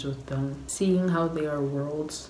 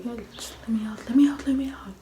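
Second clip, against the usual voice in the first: rising urgent plea; 8 semitones higher, faster than usual. Retold or imitated. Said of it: "she's like".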